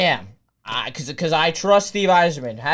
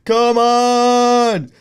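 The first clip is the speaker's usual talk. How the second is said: high, loud celebratory screaming; ecstatic screaming; 8 semitones higher, 8 dB louder than usual. Real, not acted. Streamer erupting at the draft for his team, ecstatic screaming. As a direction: lose it completely, yelling in a frantic rush.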